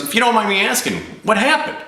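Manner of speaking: very high, delicate questioning; prying agent. Retold or imitated. Insurance agent asking a customer about his breakup, high-pitched prying. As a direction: imitate a curious agent, pitch far up.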